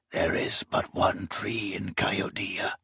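hushed eerie whisper. Acted. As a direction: breathe it like a ghostly secret. Eerie prophetic quote recalled.

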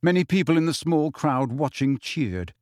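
usual speaking voice

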